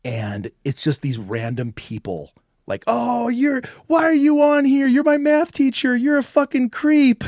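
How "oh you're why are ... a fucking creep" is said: put-on high, loud teenage shout; shocked yell. Boys yelling at their math teacher on cam, high and loud. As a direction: yell it at the creep on screen.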